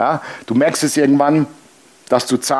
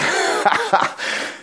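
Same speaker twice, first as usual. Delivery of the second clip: high squealing laugh; 4 dB quieter than usual. Real, not acted. Laughing at diaper story.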